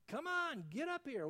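loud, high summons upward; commanding call. Acted. Preacher voicing Jesus calling believers up, loud call. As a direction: call out "Come up here" as a mighty summons.

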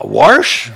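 "Warsh" with an intrusive R, questioning rise; puzzled back east pronunciation. Retold or imitated. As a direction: echo "Warsh" as a weird word, R before sh. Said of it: "That's just weird to me".

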